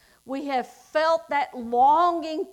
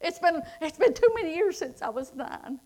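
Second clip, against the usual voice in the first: higher, louder preaching climax; 3 semitones higher, 4 dB louder than usual. Acted. Preacher tells the congregation salvation is free, louder and higher. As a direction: drive it home with force.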